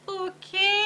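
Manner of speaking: high, unsure okay; nervous question. A narrator's reading. Skipito told to lie down, nervous high question. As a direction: ask with rising worry. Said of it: "asked Skipito nervously".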